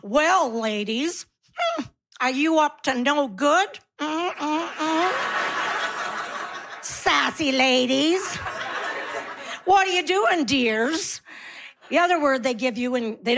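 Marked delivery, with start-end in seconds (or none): 0.0-11.2 slow, drawn-out sleazy flirting. Retold or imitated. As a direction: ham up a creepy flirt with the ladies. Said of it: "they do a flirt and call you dear".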